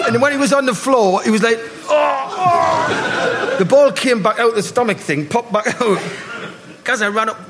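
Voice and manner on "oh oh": mock groan. Kid groaning on the floor.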